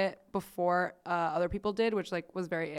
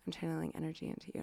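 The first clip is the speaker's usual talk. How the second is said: rushed hushed mystic; 4 semitones lower, 10 dB quieter, much faster than usual. Retold or imitated. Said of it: "A S M R".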